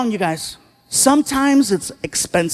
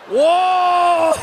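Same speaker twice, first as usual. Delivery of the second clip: long, high blissful "whoa"; exaggerated moan of pleasure; 7 semitones higher than usual. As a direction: let out a drawn-out hammed-up moan. Enjoying the shoulder rub.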